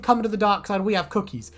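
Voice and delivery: ordinary talk, nothing marked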